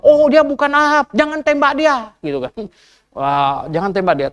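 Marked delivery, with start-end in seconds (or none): 0.0-2.1 higher, raised call to hold fire; soldiers calling off. Retold or imitated. Enemy soldiers call out not to shoot, raised. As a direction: shout to stop the shooting.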